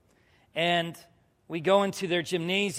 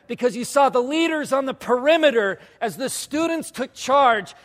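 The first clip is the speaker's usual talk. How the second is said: loud, higher-pitched testimony; fervent preaching; 7 semitones higher, 7 dB louder than usual. Real, not acted. Preacher testifies how students took charge, fervent preaching. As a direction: build excitedly over each phrase at full voice.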